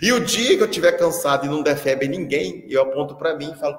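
normal talking voice

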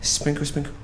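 small, high, delicate voice; dainty sprinkling. Acted. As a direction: say it lightly and softly, as if scattering drops. Acting out sprinkling water.

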